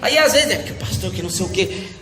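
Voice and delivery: normal talking voice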